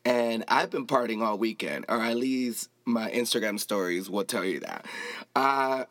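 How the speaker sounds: normal talking voice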